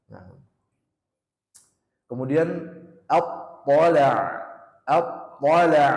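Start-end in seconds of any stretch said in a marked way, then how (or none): 3.1-5.9 slow, loud apola, holding the doubled sound; hold the shadda. Shown as a demonstration. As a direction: hold the stop, then release apola. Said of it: "ini tahan dulu di tasdidnya".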